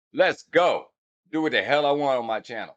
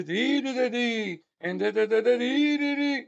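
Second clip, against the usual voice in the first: sung nonsense syllables that rise and fall, pitched higher; scatting a rising and falling cadence; 10 semitones higher than usual. Shown as a demonstration. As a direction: hum out a preacher's rolling rise and fall. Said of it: "the cadence goes up and down".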